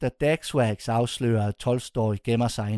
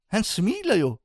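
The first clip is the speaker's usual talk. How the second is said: higher, stunned second take; amazed repeat; 8 semitones higher than usual. A narrator's reading. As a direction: say it again, now truly amazed. Repeats the realisation with wonder.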